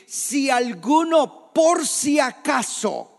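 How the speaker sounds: high, drawn-out mock cry; grito imitado. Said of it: "Jesús tiene que gritar y decir". (llamado alto y alargado, medio gritado)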